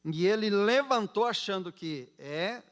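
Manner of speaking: usual speaking voice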